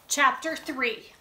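ordinary delivery, nothing marked

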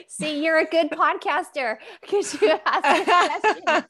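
high, loud laughing compliment. Real, not acted. To the host who turned the question, laughing. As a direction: laugh it out, amused and pleased.

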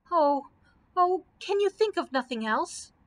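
high-pitched anxious wail; distressed cry. A narrator's reading. Dorothy begs Scarecrow for another plan, distressed cry. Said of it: "cried Dorothy".

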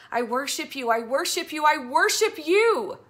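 rising jubilant cry; ecstatic shout of praise. Acted. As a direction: let joy burst out in worship. Joy bursting out.

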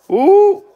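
a falsetto ooh of surprise; high ooh. Real, not acted. Reacting to chat message.

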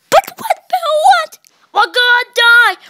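very high, loud panicked wails; panicked cry. Pretend. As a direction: shriek it like everything is falling apart. Pretend panic in rocket.

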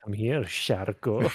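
drawn-out call to a pet, rising at the end; slow teasing sing-song call; slow sing-song coaxing. Acted. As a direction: stretch it out like luring a pet.

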